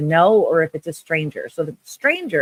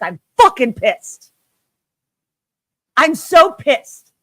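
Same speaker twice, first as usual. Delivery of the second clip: louder, higher, drawn-out furious yell; real anger; 7 semitones higher, 4 dB louder, slower than usual. Real, not acted. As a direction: spit out how angry you are. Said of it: "but I am angry".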